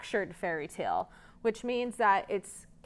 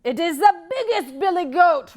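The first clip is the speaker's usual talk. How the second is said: loud, higher booming goat; big goat voice; 8 semitones higher, 8 dB louder than usual. Acted. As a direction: boom it back at the troll. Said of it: "in a great big voice of his own".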